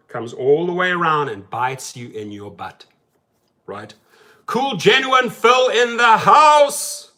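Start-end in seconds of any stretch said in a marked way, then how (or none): 4.5-7.1 loud, high, drawn-out hype greeting; enthusiastic shout-out. Real, not acted. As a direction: holler a big excited welcome to a viewer. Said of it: "here I am getting all enthusiastic".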